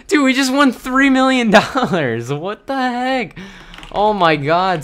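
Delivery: high, amazed shout of disbelief; excited disbelief. Real, not acted. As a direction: gasp it out, stunned by the win. Sudden huge win in game.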